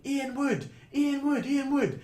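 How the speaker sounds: high, loud repeated calling of a name; mock screaming a name. Police racing through the terminal calling for the man, high and loud. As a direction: call out the name across the airport. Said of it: "police are bombing through the airport screaming".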